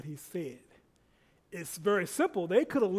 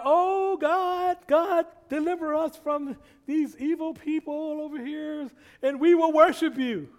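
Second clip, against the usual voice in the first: high, loud pleading cry of the Israelites; desperate cry to God; 10 semitones higher, 8 dB louder, slower than usual. Retold or imitated. Preacher voicing Israelites crying out to God for deliverance. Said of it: "They didn't cry".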